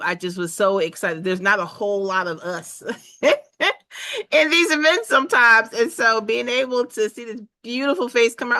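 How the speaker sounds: ordinary delivery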